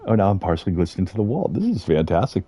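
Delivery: ordinary talk, nothing marked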